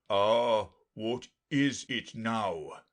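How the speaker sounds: loud opening, then drawn out; eager unicorn crying out slowly. A narrator's reading. Unicorn teasing the lion about Alice, eagerly drawn out. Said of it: "the unicorn cried eagerly".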